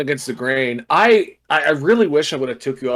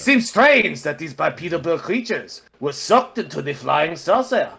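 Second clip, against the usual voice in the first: French accent. Shown as a demonstration. Podcaster reading the saucer report aloud, bad French accent. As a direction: wobbly fake French accent, a bit German. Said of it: "just in a French accent".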